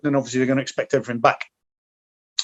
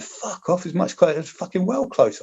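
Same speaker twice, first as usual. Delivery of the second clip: a hint of an indignant mate insisting; mock indignant mate; 7 semitones higher than usual. Mate argues RPG was close.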